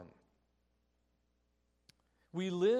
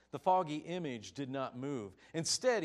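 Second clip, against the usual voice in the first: ordinary delivery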